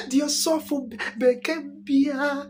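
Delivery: high, slow sing-song intoning; pastor's words. Retold or imitated. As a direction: stretch it out like a preacher. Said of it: "Mama, nyasa mpitwa".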